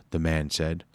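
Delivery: usual speaking voice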